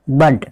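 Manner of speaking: बर्ड with a short "a" and a falling end; correct reading बर्ड. Shown as a demonstration. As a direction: say बर्ड, not बेड. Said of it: "इसको हम बर्ड पढ़ेंगे". (सही उच्चारण बर्ड, गिरते स्वर में)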